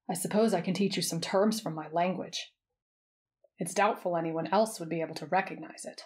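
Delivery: a hint of a measured, serious partner; Karapika. A narrator's reading. Voices Karapika, reserved partner.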